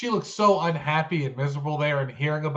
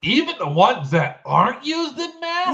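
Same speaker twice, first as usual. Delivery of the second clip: surprised; 8 semitones higher, 4 dB louder than usual. Real, not acted. Incredulous echo of cold-medicine shortage.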